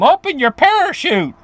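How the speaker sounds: high-pitched mock yell downward; retold yell. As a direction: act out calling down to a falling man. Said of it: "He yells down at him".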